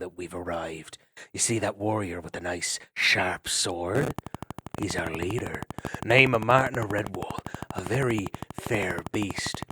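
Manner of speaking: menacing growl. Acted. Otter threatening captured ferret, a low menacing growl. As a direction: growl over the axe blade. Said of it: "growled in a menacing voice".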